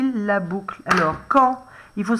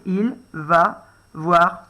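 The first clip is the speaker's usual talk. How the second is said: slow, word by word dictation; 4 semitones lower, much slower than usual. Shown as a demonstration. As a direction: say it slowly as it sounds. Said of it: "c'est comme ça se prononce". (dictée lente, mot à mot)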